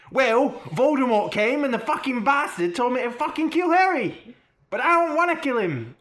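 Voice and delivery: high, loud, hammed-up Hermione accent; put-on Hermione accent. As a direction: shout it out in an exaggerated Hermione accent. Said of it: "I've lost the accent".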